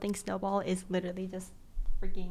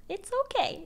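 forced cheer; 11 semitones higher than usual. Real, not acted. Forced reassurance.

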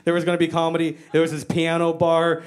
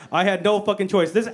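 ordinary talk

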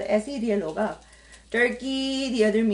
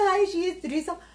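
mocking "she is threesome" as broken English, pitched higher; mocking wrong English; 8 semitones higher than usual. She mocks a viewer's broken English comment, mockingly. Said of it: "She is a threesome is कोई sentence नहीं होता".

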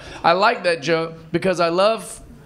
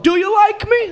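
much higher, pleading mock plea; high loud needy whine; needy comic; 4 dB louder than usual. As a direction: beg for approval in a high voice. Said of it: "It's just us going".

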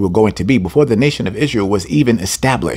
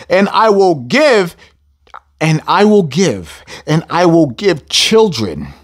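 preaching repetition; 6 semitones higher, slower than usual. Real, not acted. Preacher hammering the verse to his church. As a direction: build each "I will give" slower and heavier. Preacher repeating scripture for effect.

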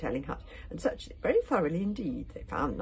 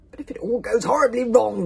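loud, raised-pitch grumble; grumbling; 6 semitones higher, 14 dB louder than usual. A narrator's reading. Outvoted warrior doubting the stand-in chief, loud grumbling. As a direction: grumble it out at full volume. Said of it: "grumbled Bagger Bear and the Bear Lady".

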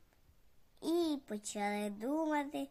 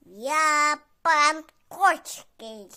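loud, slow, puffed-up announcement; grand Pan Kotsky; 12 dB louder, much slower than usual. Acted. Cat grandly introduces himself to the fox, loud and slow. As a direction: boom out the name like a proud lord. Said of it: "А вона каже".

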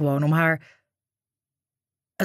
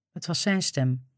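ordinary delivery

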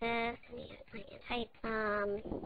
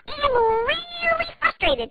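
frustrated; 13 semitones higher than usual. Acted. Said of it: "Do you guys get that?".

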